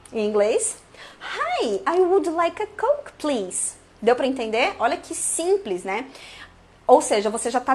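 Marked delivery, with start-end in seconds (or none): 1.2-3.7 polite request. Shown as a demonstration. Polite order demo.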